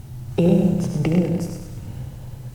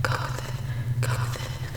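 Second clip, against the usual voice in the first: soft, voiceless whisper; coaxing whisper; 10 dB quieter, slower than usual. Pretend. Urging the listener to go with it, coaxing whisper. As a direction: whisper it like a gentle nudge.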